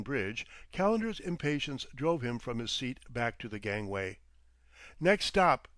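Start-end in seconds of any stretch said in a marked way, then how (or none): 5.0-5.7 short, louder and clipped off; curt order from a heavy, brooding man. A narrator's reading. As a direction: bite it off impatiently. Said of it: "he told Kirkwood curtly".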